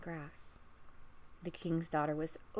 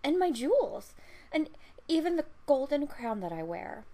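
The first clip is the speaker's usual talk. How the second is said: louder, higher, building eager offer; eager pleading; 6 semitones higher, 7 dB louder than usual. Acted. Princess offering her treasures to the frog, louder and eager. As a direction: pile on the offers, rising with each.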